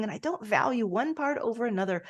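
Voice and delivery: ordinary talk, nothing marked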